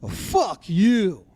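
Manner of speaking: slow, drawn-out mock curse; upset curse. Orioles manager to the Yankees pitcher, upset and drawn out. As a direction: imitate an upset manager, leaning on each word. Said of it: "like that, you know? Upset about it".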